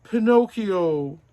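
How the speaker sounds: high, loud drawn-out cry of his name; father's astonished greeting; joyful surprise. Acted. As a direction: call out in amazed relief. Father recognises his son.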